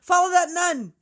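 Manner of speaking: high, loud chase command; shouted order. Acted. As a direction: yell it to launch a pursuit. Acting movie character shouting an order.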